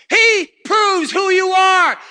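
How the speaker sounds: excited shout. Real, not acted. Preacher erupts at the congregation, excited shout. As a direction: proclaim it at full force. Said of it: "You excuse me if I get excited".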